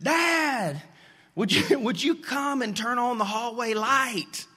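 put-on high child's voice calling for dad; a little boy calling from bed, pitched high; five-year-old calling. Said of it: "screamed out and said".

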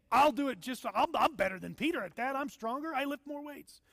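lightly voiced bragging disciples; playful improv of cocky apostles; boastful apostles improv. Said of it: "That was a little improv".

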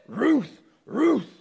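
loud barked Ruth; dog bark pun. Acted. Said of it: "the dog said".

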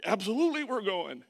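loud, high burst imitating eager parents; parents' over-eager yes, acted out; excited parents. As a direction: jump in keenly, voicing thrilled parents. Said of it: "parents are more excited than me".

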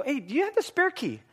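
high, too-breezy question; fake casual. Husband hiding locked-in keys from wife, fake-casual asking. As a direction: toss it out high, feigning innocence. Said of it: "I'm doing this kinda casually".

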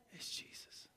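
breathy hushed whisper; preacher whispering the answer. Acted. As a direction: drop to a whisper for the reveal. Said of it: "whispered reveal".

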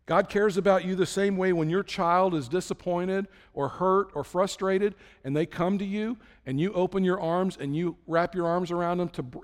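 normal talking voice

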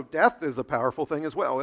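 ordinary delivery

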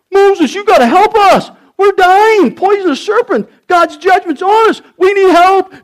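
high, loud desperate plea; crying out. Acted. People plead with Moses as they die, crying out. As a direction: shout for help in a panic, high-pitched. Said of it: "cried out".

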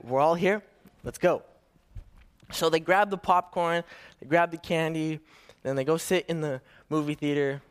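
ordinary delivery

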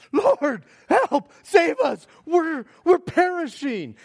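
loud, high, desperate cry for help; urgent panic. Shown as a demonstration. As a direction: shout it like the boat is sinking. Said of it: "more of a sense of urgency and panic".

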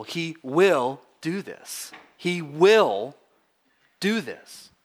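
slow, emphatic "will" stretched out; stress on will. Shown as a demonstration. Preacher insists God is faithful, heavy stress on "will". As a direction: hold "will" long, pause, then finish. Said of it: "We could underline the second word".

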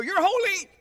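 high-pitched mock cry; demon proclaiming. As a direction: call it out high and excited. Imagined demon proclaiming someone holy.

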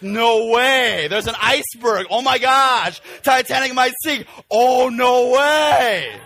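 high, loud sarcastic gasps, stretched out at the end; hammed-up mock amazement; mocking fake surprise. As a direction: ridicule the Titanic audience's shock. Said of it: "what the heck was the climax".

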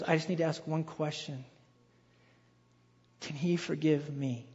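low, soft, hesitant question; hushed retelling of a vulnerable question; retold humble plea. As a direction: ask it quietly, as if unsure of the answer. Drug dealer asking for forgiveness.

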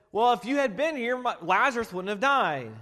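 retold as an accusing complaint; accusing reproach. Preacher voices Mary blaming Jesus with accusing reproach. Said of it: "These women are attacking him".

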